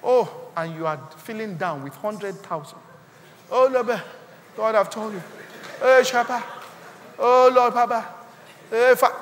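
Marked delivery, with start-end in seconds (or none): 3.5-9.2 mock desperate cry to the Lord, louder and raised; loud pleading prayer. Preacher acts out someone begging God about money, loud mock pleading. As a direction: call out to God in hammed-up pleading bursts.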